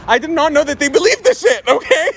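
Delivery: high, laughing disbelief. Real, not acted. Laughing at her family believing it. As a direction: laugh through the words.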